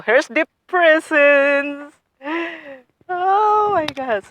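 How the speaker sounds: high, slow, drawn-out thrill; excited reveal. Real, not acted. Gardener opening the propagation like a present, thrilled. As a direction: stretch it out high, like unveiling a present. Revealing long-awaited roots to viewers.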